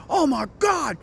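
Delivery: retelling a scared friend's shouting over the phone; frantic shout. Retold or imitated. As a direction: yell like someone who just got scared half to death. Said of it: "He's like".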